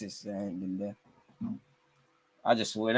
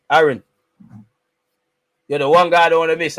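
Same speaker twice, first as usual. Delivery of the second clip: calling out; 3 semitones higher, 9 dB louder than usual. Real, not acted. Calling out to penalty taker.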